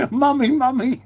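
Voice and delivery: put-on high, childish whine of mummy, mummy; mocking a drunk man calling his mum. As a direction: mimic a grown man crying for his mum, high and whiny. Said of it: "call his mum".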